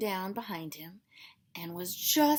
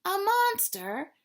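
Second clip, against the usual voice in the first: chuckling; 10 semitones higher, 7 dB louder, slower than usual. Acted. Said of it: "chuckled Rabbit".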